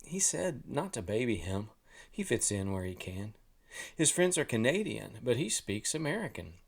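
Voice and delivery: ordinary delivery, nothing marked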